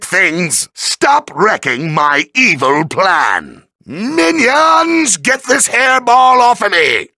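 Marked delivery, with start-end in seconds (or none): 3.8-7.1 high, frantic villain yell; calling minions. Acted. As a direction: yell for your henchmen to pull an attacker off. Villain calls minions for help.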